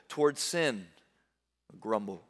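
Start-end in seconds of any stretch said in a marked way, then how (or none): 1.8-2.2 low, soft grumbling crowd; preacher voicing a grumbling crowd, low and sulky; mock grumble. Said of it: "They grumble".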